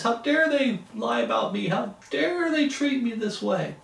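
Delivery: high, slow, wounded pride; puffed-up offended elder; indignant rant. Pretend. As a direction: demand respect for your title. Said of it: "And start name-calling".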